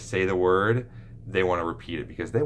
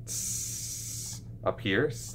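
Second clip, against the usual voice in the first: short hissed S; crisp hiss; 7 dB quieter, much slower than usual. Shown as a demonstration. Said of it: "behind your front teeth".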